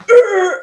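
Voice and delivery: high, loud gut-punched groan; a groan as if hit in the stomach; pained ugh. Retold or imitated. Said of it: "And I was like".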